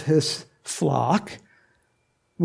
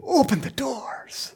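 hushed royal command; 8 semitones higher, 4 dB quieter, slower than usual. Acted. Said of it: "he's gonna go".

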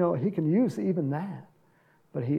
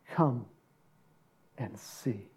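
gentle invitation; 6 semitones lower, 7 dB quieter, slower than usual. Shown as a demonstration.

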